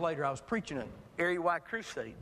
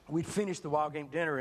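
ordinary talk

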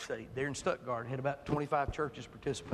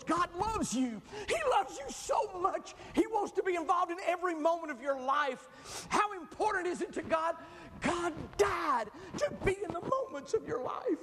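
passionate preaching; 13 semitones higher than usual. Acted. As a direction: preach it soaring to the room. Preaching at full force.

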